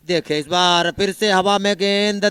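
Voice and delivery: ordinary delivery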